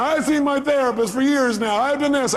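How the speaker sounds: high imitation of an exasperated client; mock-defensive complaint; protesting caller. Voices caller protesting to the coach.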